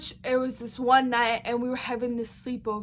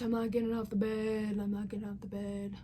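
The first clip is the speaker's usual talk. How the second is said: a fading, half-asleep mumble; 2 semitones lower, 7 dB quieter than usual. Retold or imitated. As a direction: let it trail off sleepily. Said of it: "I was half asleep".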